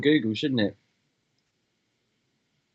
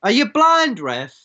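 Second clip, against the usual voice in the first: loud, high shout at the ref; yelling from the sidelines; 7 semitones higher, 6 dB louder than usual. Real, not acted. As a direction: heckle the referee. Fan yelling at the referee.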